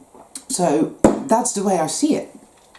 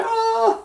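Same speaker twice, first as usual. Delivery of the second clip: mock panicked cry.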